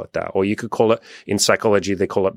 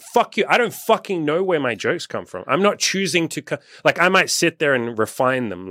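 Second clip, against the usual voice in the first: mock outburst, much higher pitched; imitating an angry comedian; 7 semitones higher than usual. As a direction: snap back like an irritated comedian. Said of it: "some of them would get fucking mad 'cause they're like".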